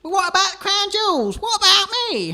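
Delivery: high, loud, plaintive; whiny protest. Acted. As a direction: whine after them as they leave. Witness protesting abandonment.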